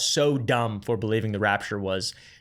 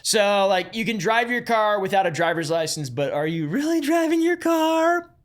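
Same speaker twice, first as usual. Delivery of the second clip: mocking question rising much higher; sarcastic; 8 semitones higher, 3 dB louder than usual. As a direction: sneer it, pitch climbing high. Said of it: "Being sarcastic".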